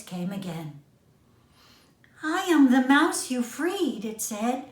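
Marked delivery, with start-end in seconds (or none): 2.2-4.0 mouse voice. A narrator's reading.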